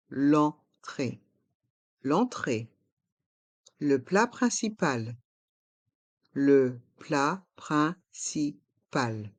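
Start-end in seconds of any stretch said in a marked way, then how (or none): 0.1-1.2 "L'entrée" broken into two slow syllables; slow model pronunciation. Shown as a demonstration. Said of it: "font le son en". 6.3-9.3 "Le plat principal" in slow, separate syllables; slow model pronunciation. Shown as a demonstration. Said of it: "Prononçons".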